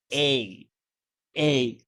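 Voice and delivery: "A" said alone as a long, open glide; vowel demonstration. Shown as a demonstration. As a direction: model the letter "A" on its own, mouth open, held clearly. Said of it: "Take note of the pronunciation here".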